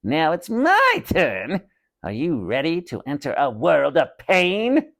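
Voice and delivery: slow menacing puppet voice; evil dummy threatening the two boys; evil dummy rasp. Acted. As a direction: savor the threat like a villain. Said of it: "Slappy rasped".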